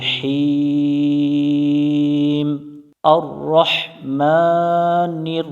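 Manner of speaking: slow, drawn-out chanted recitation; Quranic recitation. Shown as a demonstration. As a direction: chant it slowly like tajweed.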